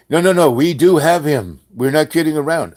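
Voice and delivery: loud, high, urgent protest; insistent caller. Retold or imitated. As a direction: imitate a caller insisting he means it. Caller insists on his threat.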